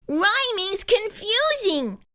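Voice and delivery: put-on a young rock, frowning and lost; frowning Ricky. A narrator's reading. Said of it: "Ricky said with a frown".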